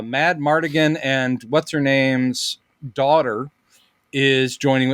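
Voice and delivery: usual speaking voice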